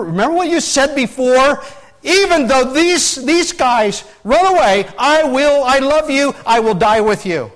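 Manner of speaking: high, loud preacher's declaration; impassioned vow. Retold or imitated. Disciple's pledge of loyalty to Jesus, impassioned vow. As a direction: belt out the pledge with fervour. Acts Peter's earlier fervent vow.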